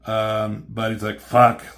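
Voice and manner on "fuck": a sudden loud burst of frustration; loud curse. Retold or imitated. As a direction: spit the swear out hard. Hero cursing his weakness.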